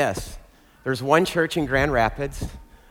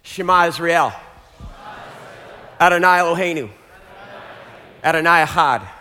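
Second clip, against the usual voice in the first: loud, chanted lines for the crowd to echo; leading call and response; 4 semitones higher, 6 dB louder, faster than usual. Real, not acted. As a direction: proclaim each phrase, then pause for the echo. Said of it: "Repeat after me".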